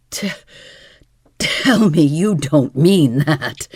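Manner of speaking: tripping over the first word; stumbling, struggling for words; stammering. A narrator's reading. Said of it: "had to struggle for words".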